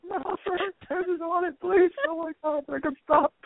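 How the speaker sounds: very high, comic squeal; hammed-up squealing plea. Trapped woman begging with arm caught, hammed-up squeal. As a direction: plead in a silly falsetto, losing it.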